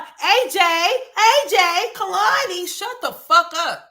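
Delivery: high, loud mocking mother voice; hammed-up imitation of a yelling mother; mock screeching mom. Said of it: "When she speaks, she just like".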